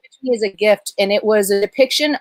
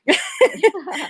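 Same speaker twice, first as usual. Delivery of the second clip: teary laugh; 8 semitones higher than usual. Real, not acted. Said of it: "now I'm gonna cry".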